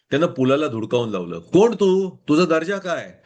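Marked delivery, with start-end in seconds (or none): 1.4-3.1 haughty nobleman snapping with contempt; lightly voiced proud father, dismissive; arrogant roar. A narrator's reading. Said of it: "Ramapati गरजला".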